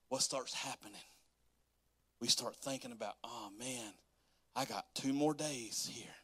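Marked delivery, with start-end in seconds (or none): 3.2-6.1 softly whispered, dreading the last days; mock whisper. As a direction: breathe it like a sad secret. Dreading the end of vacation.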